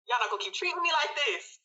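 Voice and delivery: high, fast indignant protest; retold angry retort. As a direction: rush it out, pitched right up. Retold quarrel with family.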